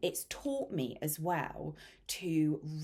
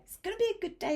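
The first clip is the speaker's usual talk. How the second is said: high carefree sing-song; breezy, skipping-along delight; sunny sing-song of a good day; sing-song cheer; 3 dB louder, much faster than usual. Retold or imitated.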